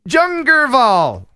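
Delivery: "Jungerval" loud, high and drawn out; hammed-up foreign name. Shown as a demonstration. Host reads out a Swedish candy name, hammed-up and loud. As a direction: stretch "Jungerval" grandly, falling at the end.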